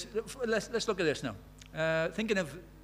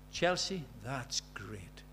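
quiet praise; 4 semitones lower, 7 dB quieter, much slower than usual. Retold or imitated. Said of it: "she hears him say".

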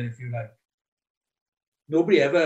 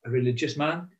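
usual speaking voice

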